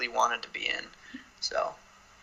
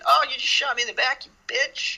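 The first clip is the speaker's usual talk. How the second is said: high, loud mock complaint; hammed-up outrage at being wronged; mock indignant victim; 10 semitones higher, 4 dB louder than usual. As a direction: protest in an exaggerated high voice. Acting out the shot suitor.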